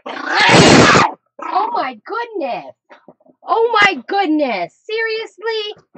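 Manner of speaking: high, drawn-out disbelief; scandalized exclamation. Real, not acted. Owner reacting to her screaming dog, real exasperated disbelief. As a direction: stretch the words out high, aghast at the pet.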